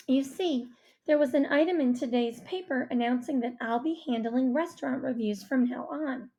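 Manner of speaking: usual speaking voice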